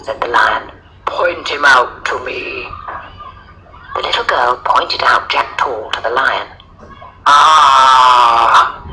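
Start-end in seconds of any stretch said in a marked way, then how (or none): 1.1-2.7 slow, menacing lion voice. A narrator's reading. Lion wanting the bully shown, slow lion voice. 7.3-8.8 loud, drawn-out lion "Ah"; long sound of a big cat spotting prey. A narrator's reading. Lion spotting the bully, loud lion voice.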